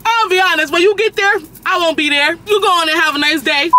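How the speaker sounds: high, loud sassy brush-off; loud retold telling-off. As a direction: talk up and loud like you're done with her.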